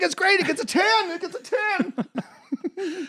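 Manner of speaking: very high, strained terrified praise; mock panicked squeal. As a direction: squeal the rave as if under attack. Critic's panicked reversal, gremlins behind him.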